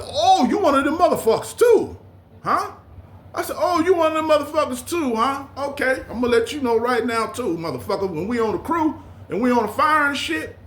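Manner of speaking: retold jailhouse challenge, voice jumping high; mock confrontation. Confronting inmate over the book.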